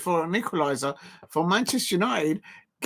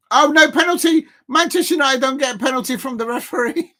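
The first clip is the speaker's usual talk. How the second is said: indignant shout; 6 semitones higher, 6 dB louder than usual. Real, not acted. Outrage at a refused penalty.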